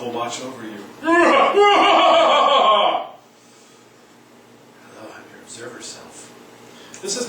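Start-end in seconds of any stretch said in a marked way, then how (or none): 0.8-3.1 big laugh. Real, not acted. Laughs at observer self. 4.9-6.3 whispered eerie hello; spooky whisper. Pretend. Teacher voices the observer self as a spooky whisper.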